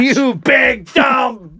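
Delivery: very slow, high drawn-out shout; hammed-up echo of the insult; mock yelling. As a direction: drag every word out in a high yell.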